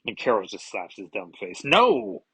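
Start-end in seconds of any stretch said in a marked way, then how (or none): 1.6-2.2 loud, higher outburst; shouted no. Acted. Carol slaps Thor and yells at him, loud outburst. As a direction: explode with a single no.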